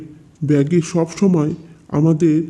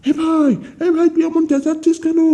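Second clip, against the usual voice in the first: frightened call; 10 semitones higher than usual. Acted. Sister calls to possessed brother.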